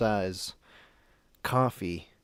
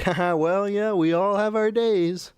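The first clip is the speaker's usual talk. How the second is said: higher, louder forced-cheerful laugh-along; nervous placating; 9 semitones higher, 5 dB louder than usual. Retold or imitated. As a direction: humour a scary man to avoid being yelled at. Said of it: "I didn't want him to yell".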